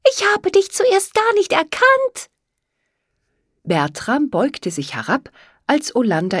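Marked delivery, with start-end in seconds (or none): 0.1-2.3 very high-pitched, delighted character voice; thrilled reunion in a high voice; happy exclamation. A narrator's reading. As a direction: pipe up joyfully, far above your usual pitch. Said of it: "rief Olanda in diesem Augenblick glücklich".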